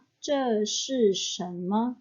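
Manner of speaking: slow, careful Mandarin model read; slow model sentence. Shown as a demonstration. Teacher reading an example sentence to students, slow and careful. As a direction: spell it out slowly for learners. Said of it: "Jadi arti kalimat ini".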